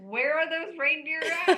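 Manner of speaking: asking loudly and brightly; calling out. Retold or imitated. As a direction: call the question across the room. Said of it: "Nicole goes".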